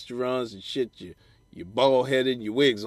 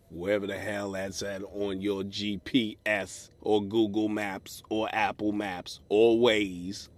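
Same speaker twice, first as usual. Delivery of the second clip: ordinary talk, nothing marked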